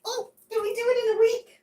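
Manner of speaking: very high squeaky plea; high begging little voice; pleading. Real, not acted. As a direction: beg them sweetly. Pleading to viewers.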